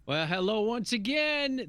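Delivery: show intro. Acted. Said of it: "This is Podcasting for Dummies".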